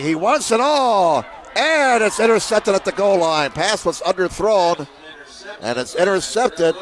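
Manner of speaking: rising alarm as the ball is picked off; excited interception call. Real, not acted.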